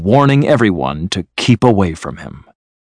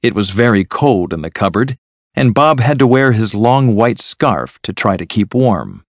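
plain reading voice

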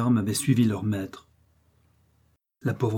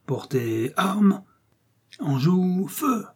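drawn-out drill orders climbing very high; military command; 8 semitones higher, much slower than usual. A narrator's reading. Boy drilling himself on his march, mock military command. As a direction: play the little officer, pitch rising to the last order. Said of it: "sa promenade militaire de cris".

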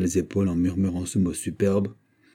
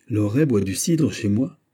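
usual speaking voice

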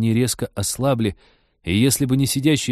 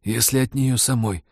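hoarse wheeze. Acted. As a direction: rasp it out, barely getting words out. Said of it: "прохрипел Роджер".

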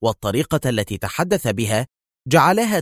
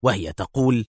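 usual speaking voice

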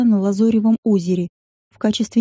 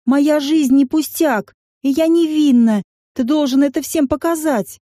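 hurt shout; 5 semitones higher than usual. Acted. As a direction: cry out, deeply offended. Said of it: "обиженно выкрикнула".

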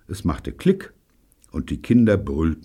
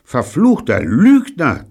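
loud, high, slow insult; drawn-out angry accusation; furious hiss; 8 semitones higher, 7 dB louder than usual. A narrator's reading. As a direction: spit it out furiously. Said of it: "zischte Evelyn wütend".

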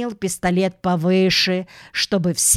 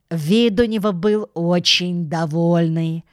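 ordinary delivery, nothing marked

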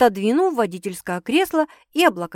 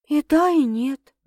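slow, level, slightly raised pitch; even; 6 semitones higher, 3 dB louder, slower than usual. A narrator's reading. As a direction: answer evenly and unhurriedly. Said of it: "ровно ответила Саша". (ровно, медленно)

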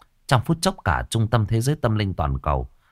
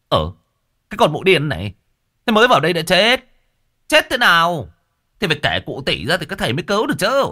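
lightly voiced scolding shaman; 8 semitones higher, 3 dB louder, faster than usual. A narrator's reading. Shaman scolds wailing woman.

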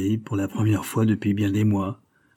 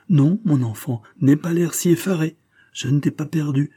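higher, louder plea from a mother; mother's cry; 7 semitones higher, 4 dB louder than usual. A narrator's reading. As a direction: cry out to calm a frightened son. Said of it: "s'écria-t-elle". (cri de mère, plus aigu et plus fort)

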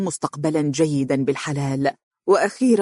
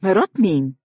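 mildly agitated question; 3 semitones higher than usual. A narrator's reading. Amr demands to know whose wife, agitated. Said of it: "وهو يقول منفعلا".

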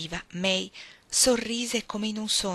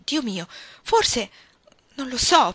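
slow start, then bursts out louder; angry outburst; 4 semitones higher, 7 dB louder, slower than usual. A narrator's reading. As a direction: snaps back in angry frustration. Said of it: "proruppe irosamente". (scoppio d'ira, prima lento poi più forte)

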